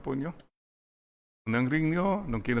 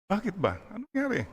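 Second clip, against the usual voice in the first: high, alarmed wife asking what happened; a wife startled by her husband's odd behaviour; 9 semitones higher, faster than usual. Retold or imitated. Priest voicing the startled wife in a joke, pitched high.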